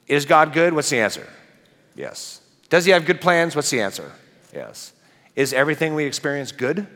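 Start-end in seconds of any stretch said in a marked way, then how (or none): 1.9-2.4 congregation answer. Real, not acted. 4.5-4.9 congregation answer. Real, not acted.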